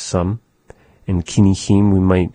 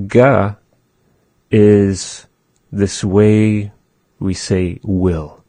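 normal talking voice

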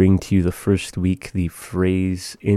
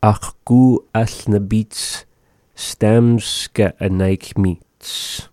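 slow; much slower than usual. Shown as a demonstration. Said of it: "repeating phrase slowly".

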